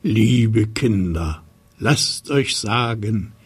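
put-on slow, measured chant to children; nightwatchman rhyme. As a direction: call it like a tower watchman. Turmwächter-Reim zum Sendungsbeginn. (langsam gereimter Wächterruf)

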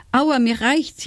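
high, pained wail of complaint; whining. A narrator's reading. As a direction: whine like a beaten wolf. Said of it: "jammerte der Wolf schließlich".

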